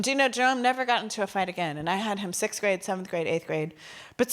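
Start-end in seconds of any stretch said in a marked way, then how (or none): none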